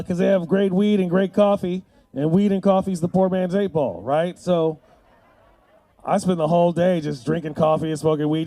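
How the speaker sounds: normal talking voice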